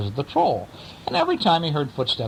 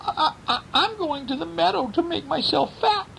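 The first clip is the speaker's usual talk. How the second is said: much higher squeaky little goat; tiny voice. Acted. Littlest goat answers the troll in a high tiny voice. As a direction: make yourself small and squeak. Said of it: "spoke in a tiny voice".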